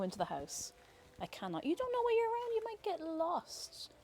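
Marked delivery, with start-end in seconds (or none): 1.6-3.9 high, softer voice for the cat, slowing down; cat-talk voice. Real, not acted. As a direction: gently explain to the cat. Telling cat it can't come in.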